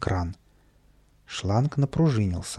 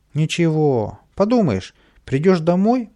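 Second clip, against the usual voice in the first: louder, higher, carefree brush-off; беспечно уговаривает; 6 semitones higher, 6 dB louder than usual. A narrator's reading. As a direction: wave away a friend's worry. Каприска отмахивается от возражений. (беспечно, задорно)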